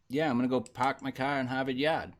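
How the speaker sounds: mock Boston accent, dropped r's. Joking about a Boston reviewer, mock Boston accent. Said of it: "heavy Boston accent".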